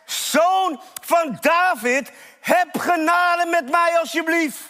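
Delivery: high, insistent plea for mercy; desperate acted shout to be heard; crying out even harder. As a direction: stretch the name, then beg urgently. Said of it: "hij schreeuwt des te harder".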